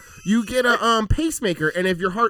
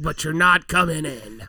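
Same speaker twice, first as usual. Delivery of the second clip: normal talking voice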